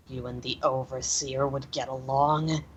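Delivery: low, quiet mutter under the breath. Acted. Leilani glaring at the captive, low mutter. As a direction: mutter it, soft and resentful. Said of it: "glares at him and mutters".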